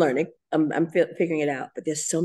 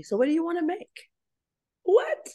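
overwhelmed; 12 semitones higher than usual. Retold or imitated. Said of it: "saying to me".

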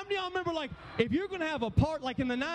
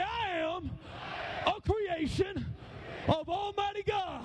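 higher, slow warrior declaration; drawn-out warrior chant, pitched up; 4 semitones higher, 4 dB quieter, slower than usual. Shown as a demonstration. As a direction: lead a battle cry in short phrases. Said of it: "say it like a warrior".

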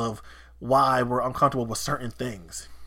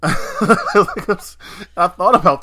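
laughing while talking; 5 semitones higher, 9 dB louder, much slower than usual. Real, not acted.

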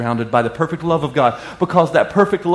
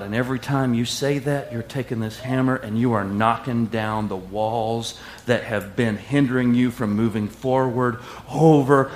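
soft closing affirmation; 5 semitones lower, 6 dB quieter than usual. Acted. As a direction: settle them into quiet assurance.

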